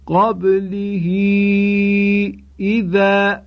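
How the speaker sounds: قبله با های ضمیر کشیده، قبلهو; recitation with elongation. Shown as a demonstration. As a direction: chant قبله اذا with the ha lengthened. (های ضمیر در قبله را اشباع کن)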